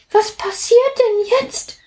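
high, surprised little water drop. Acted. Plitsch sees drops leap out of the cloud, high and surprised. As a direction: squeak it out in wide-eyed surprise. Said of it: "fragte Plitsch überrascht".

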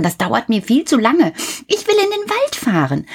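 put-on high, quick impatient boy; impatient Häwelmann. A narrator's reading. Häwelmann tells the moon he is bored, high and impatient. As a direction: whine that it takes too long. Impatient child voiced in story.

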